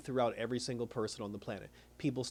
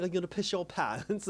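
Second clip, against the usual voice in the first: mocking, higher-pitched question; taunting mother; 4 semitones higher, 3 dB quieter than usual. Mother belittling her upset son, taunting. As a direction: needle him like he's a baby.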